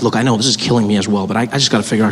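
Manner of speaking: low official's reply; voiced safety official. Official pleading with the air hostess.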